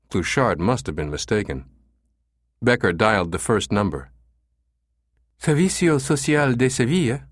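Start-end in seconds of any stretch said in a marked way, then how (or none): 5.4-7.3 higher, pleasant Spanish receptionist; pleasant female voice. A narrator's reading. Escort agency receptionist answering a call, pleasant female voice. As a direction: answer the phone as a pleasant woman. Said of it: "a pleasant female voice answered".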